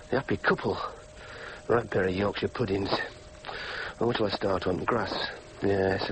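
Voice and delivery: low, halting inner monologue; weary musing. Acted. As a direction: mull it over glumly to yourself, pausing often. Alone, musing over wedding photo.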